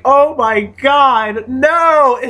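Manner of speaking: high, drawn-out oh my god no; mock horrified no. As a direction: replay the dismay, stretched and high. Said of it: "I was like".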